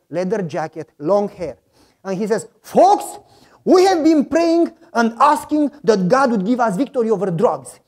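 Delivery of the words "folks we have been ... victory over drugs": biker rallying the group. Retold or imitated.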